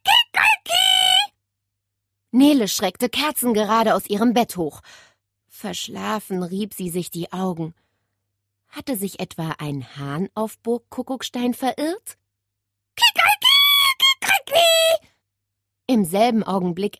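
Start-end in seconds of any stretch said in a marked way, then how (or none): 0.0-1.3 high loud crow, drawn out and slow; slower rooster crow. Acted. Parrot crowing again at Nele's window, slow loud crow. 12.9-15.1 high shrill crowing cock-a-doodle, loud; rooster crow. Acted. Parrot crowing to wake Nele, loud rooster call.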